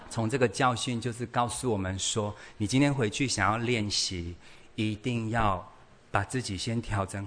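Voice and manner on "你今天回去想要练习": ordinary talk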